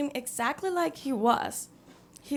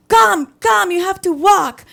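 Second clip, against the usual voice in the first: loud, higher, pushy command; impatient gunman yelling; 7 semitones higher, 11 dB louder than usual. Retold or imitated. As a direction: bark at her to keep walking. Gunman urging her on.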